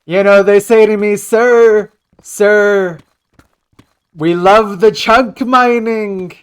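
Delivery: high, loud bragging president bit; hammed-up presidential impression, mannerisms over accuracy; Donald Trump. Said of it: "I'm better at his mannerisms than his actual voice".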